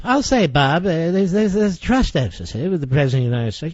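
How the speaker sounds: mock Washington insider. Host mocking the senator's careful Washington phrasing. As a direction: parrot the senator's evasive talk, quick and hedging. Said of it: "This is the ruling class talking to itself".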